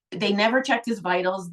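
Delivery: ordinary delivery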